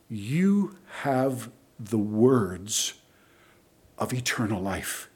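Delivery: slow, low, hushed declaration; solemn quoted confession of faith; reverent. Retold or imitated. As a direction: let each phrase sink in, softly. Preacher voices Peter's solemn answer.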